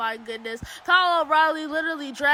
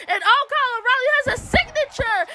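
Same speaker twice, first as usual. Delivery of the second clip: surprised; 8 semitones higher, faster than usual. Real, not acted. Surprised mid-match commentary.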